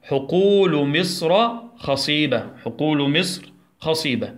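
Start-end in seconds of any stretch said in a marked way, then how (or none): 0.0-2.5 slow, clear classroom reading; teacher modelling a sentence, slowly; slow model sentence. Shown as a demonstration. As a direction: read حقول مصر خصيبة slowly for children to repeat. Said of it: "يلا ندخلها في جملة".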